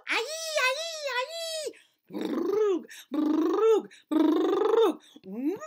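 loud, squeaky, rising gibberish; silly nonsense sounds. Acted. Teacher reading the gibberish page to kids, loud squeaky nonsense. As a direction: rattle off the nonsense wildly. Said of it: "make silly sounds like".

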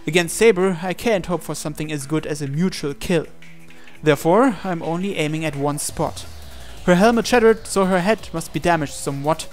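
ordinary delivery

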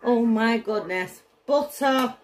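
ordinary delivery

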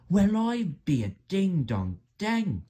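high, slow, drawn-out exclamation; amazed driver. A narrator's reading. Driver astonished as the race begins, high and slow. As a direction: marvel in a high character voice. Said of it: "said the driver".

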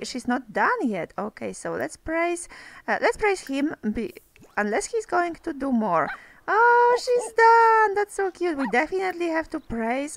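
usual speaking voice

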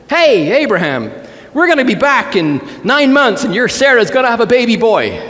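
high, sing-out announcement; calling out news. Acted. Visitor tells Abraham of a baby boy, calling out. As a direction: call it over, pitched well up.

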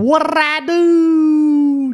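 very high, loud, long-drawn mimicking whine; mocking wail. Admin parroting the banned player's protest, mocking wail. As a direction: stretch the last word into a mock howl.

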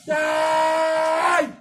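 hammed-up shouted curse; mock battle cry. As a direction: yell it like a cartoon villain. Mock magic attack on balloon.

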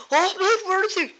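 very high, loud panicked cry; frantic. Acted. Bandmate searching for missing Luigi, frantic.

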